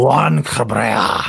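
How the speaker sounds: put-on slow, grand Spanish accent on Juan Cabrera; mock Spanish accent; Spanish name rolled out. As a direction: announce the name like a telenovela.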